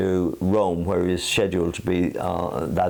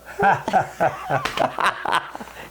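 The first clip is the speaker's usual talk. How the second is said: breathy laugh at own joke; 8 semitones higher than usual. Real, not acted.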